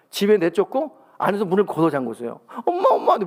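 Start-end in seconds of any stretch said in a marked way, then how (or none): 2.7-3.2 put-on high pleading child's call; child calling mom. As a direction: cry out for mom at the locked door. Child locked out calling mother. (문 밖에서 엄마를 부르는 아이, 높게 애원하듯)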